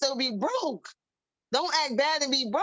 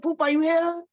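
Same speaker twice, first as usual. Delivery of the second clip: surprised; 4 semitones higher, 5 dB louder than usual. Retold or imitated. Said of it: "I was like".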